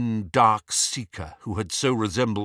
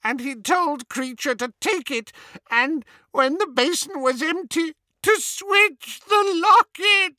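very high elf voice rising into sobs; sobbing house-elf; 4 dB louder than usual. A narrator's reading. Elf recounting his master's orders, breaking into sobs. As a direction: drag the last words out, tearful and high. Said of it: "Kreacher's sobs came in great rasps now".

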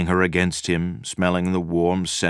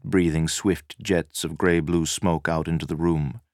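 ordinary delivery, nothing marked

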